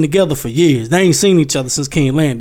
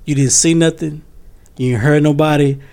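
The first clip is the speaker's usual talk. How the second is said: ordinary talk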